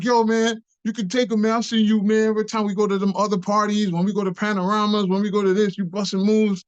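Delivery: high, rapid-fire pep talk; excited, high-pitched urging, acted out; hyping up a friend. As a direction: egg your buddy on in a rush. Friends hype him up to battle.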